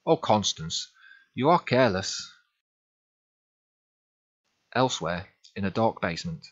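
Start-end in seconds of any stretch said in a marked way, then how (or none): 0.0-2.3 put-on scolding in a German accent, rising higher; German-accented reproach; thick German accent. A narrator's reading. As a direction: chide her with a heavy German accent. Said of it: "in her thick German accent".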